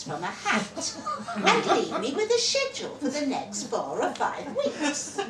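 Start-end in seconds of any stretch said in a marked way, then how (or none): 1.4-5.3 laughing. A narrator's reading. Narrator laughing at scheme.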